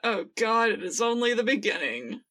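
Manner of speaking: ordinary delivery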